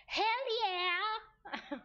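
high, drawn-out wrestler's battle cry; big rowdy wrestler yell; Stone Cold Steve Austin. Acted. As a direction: holler it like a wrestling champion. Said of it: "in the words of Stone Cold Steve Austin".

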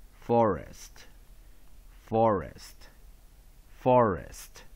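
"forest" with a full "or" in the first syllable, weak ending; diphthong "or" demonstration. Shown as a demonstration. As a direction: model "forest" slowly for students, stressing FOR. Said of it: "O R as diphthong or".

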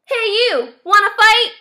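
high, loud picking a fight; cocky little bug calling out; grouchy ladybug. A narrator's reading. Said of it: "the grouchy ladybug said".

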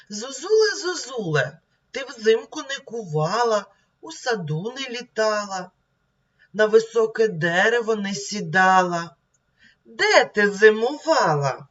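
high, lively reading aloud of a poem; expressive reading. Shown as a demonstration. Teacher reading the cuckoo poem to the class, expressive. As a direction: read the children's verse out brightly. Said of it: "Давайте прочитаємо".

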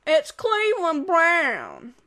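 loud, slow cartoon voice with a chuckle; hammed-up drawn-out sitcom intro voice; Cleveland Brown. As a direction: stretch it out big, then laugh in character. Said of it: "Cleveland impression. I can do better than what I did".

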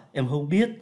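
ordinary talk

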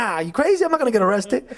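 high-pitched mock protest; incredulous refusal. Friend refusing director's invitation to come down, incredulous. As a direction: imitate a friend balking in disbelief.

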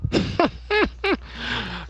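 high burst of laughter; laughing at the play. Real, not acted. Laughing at a teammate's play, high laugh. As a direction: crack up at the play.

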